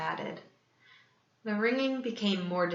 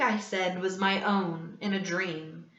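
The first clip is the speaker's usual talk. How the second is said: ordinary delivery, nothing marked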